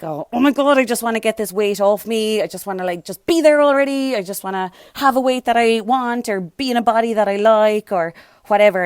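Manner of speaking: anxious impatience. Retold or imitated. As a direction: voice an impatient dieter's anxious rush. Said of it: "there's a lot of anxiety".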